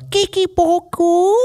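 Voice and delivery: high, squeaky gibberish Brit; badly faked British babble; silly fake British accent. Shown as a demonstration. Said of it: "Mine is more of just like".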